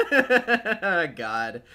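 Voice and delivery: loud, high laugh into the word; real laughing. Real, not acted. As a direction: burst out laughing, then say it. Cracks up at the reference.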